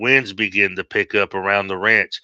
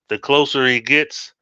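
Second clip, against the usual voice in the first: ordinary delivery, nothing marked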